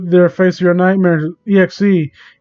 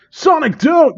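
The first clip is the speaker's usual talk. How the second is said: mock frightened plea; 9 semitones higher than usual. Repeated higher plea.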